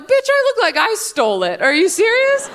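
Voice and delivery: incredulous. Retold or imitated. Comic snapping back at a friend, incredulous and high.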